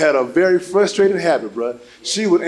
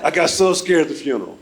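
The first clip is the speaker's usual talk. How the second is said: ordinary talk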